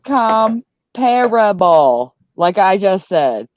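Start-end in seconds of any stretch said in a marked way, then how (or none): 0.0-2.2 Comparable stretched very slowly; sounding out words. Shown as a demonstration. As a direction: drag out Comparable syllable by syllable. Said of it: "That's how you just sounded it out".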